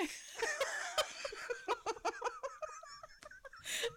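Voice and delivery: high, helpless laughing fit; big laugh. Real, not acted. Cracking up at the story's punchline. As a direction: laugh till it hurts.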